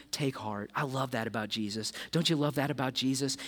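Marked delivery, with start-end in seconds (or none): 0.1-0.7 much lower and softer, tender; preacher comforting the congregation, soft and tender; gentle reassurance. Real, not acted. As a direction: let it settle on the listener gently. Preacher repeats Jesus' comfort.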